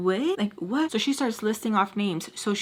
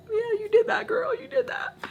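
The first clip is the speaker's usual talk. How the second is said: very high, squeaky cheering voice; God. Acted. Congratulating her on taking her own car, high-pitched praise. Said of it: "God even shows me".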